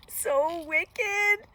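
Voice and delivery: high, loud, gleeful villain; gloating wolf. A narrator's reading. Wolf enormously pleased with himself, gleeful gloating. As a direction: squeal it with wicked relish.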